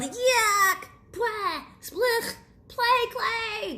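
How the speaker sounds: high, loud disgusted shriek; disgusted yell. Acted. Boy biting a clay cookie, yelling in high disgust. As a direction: spit out the awful taste, yelling. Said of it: "And he yelled".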